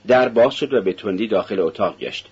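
plain reading voice

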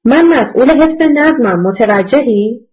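plain reading voice

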